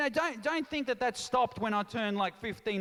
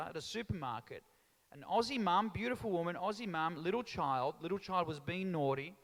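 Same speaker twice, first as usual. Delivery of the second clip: ordinary talk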